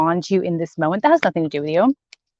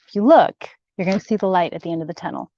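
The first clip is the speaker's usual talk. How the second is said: ordinary delivery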